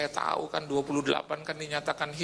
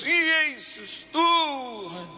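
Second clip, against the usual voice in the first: desperate cry; 8 semitones higher, much slower than usual. Retold or imitated. Preacher reenacting his cry to God in fear. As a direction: cry out to the Lord. Crying out during turbulence.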